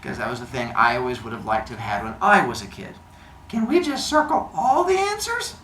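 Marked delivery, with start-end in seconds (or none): 3.5-5.6 put-on high-pitched kid asking hopefully; imitated student. Teacher mimicking a student asking about the rules, high imitated kid voice.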